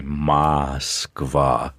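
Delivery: "Moskva" with a heavy Moscow Russian accent, slow. Shown as a demonstration. Showing a real Muscovite's heavy Russian accent on "Moskva". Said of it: "with that sort of heavy Moscow accent".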